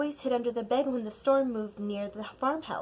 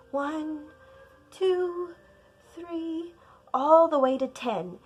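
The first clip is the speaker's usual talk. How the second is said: higher, drawn-out count; 6 semitones higher, 5 dB louder, slower than usual. Acted. Scared child counting the lightning, higher. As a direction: count slowly and nervously.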